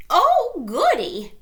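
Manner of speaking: very high, loud and gleeful; high excited grandma voice; delighted granny. A narrator's reading. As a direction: squeal it like a happy old lady. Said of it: "said Granny".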